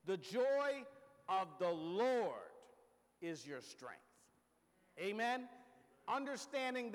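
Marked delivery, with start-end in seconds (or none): 0.0-4.0 "The joy", "of the Lord", "is your strength" split by pauses, "joy" leaned on; phrased with pauses. Shown as a demonstration. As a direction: pause between each part, slowing on "Lord". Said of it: "when you see the emphasis this way".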